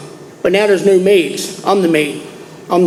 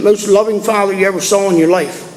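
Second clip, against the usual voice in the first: usual speaking voice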